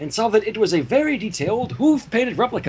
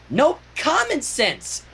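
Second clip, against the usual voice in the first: slow, higher sing-song pony; Pinky voice; 9 semitones higher, much slower than usual. Acted. Pinky answering Twilight smugly, slow and higher. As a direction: lean into common with a grin.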